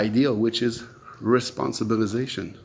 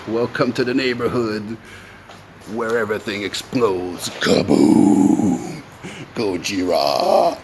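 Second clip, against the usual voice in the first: mock doomsday welcome; 2 semitones lower than usual. Acting out people's vision of anarchy, mock doomsday welcome.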